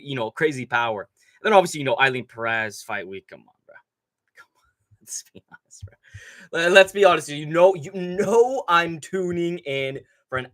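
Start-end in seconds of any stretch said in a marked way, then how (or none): 3.3-5.9 whispered, half-joking aside after a laugh; mock whisper. Streamer joking about fight week. 6.5-7.3 said out loud and louder, pressing the point; now say it openly to chat; loud repeat. Acted.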